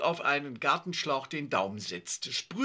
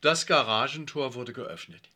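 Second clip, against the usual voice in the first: ordinary delivery, nothing marked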